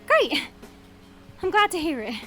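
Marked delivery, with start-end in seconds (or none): 0.1-0.4 high, loud burst of cheer; delighted relief. Acted. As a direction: exclaim it brightly, pitch jumping up. Relieved at being forgiven.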